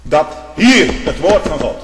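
loud sermon delivery; fiery preaching. Real, not acted. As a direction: preach it with full force. Preacher at full force.

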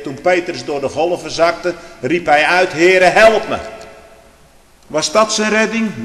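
loud, high pulpit voice; fiery preaching. Acted. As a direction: thunder it from the pulpit. Preaching at full force. (vurig preken)

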